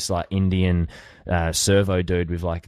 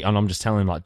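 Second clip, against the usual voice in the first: ordinary talk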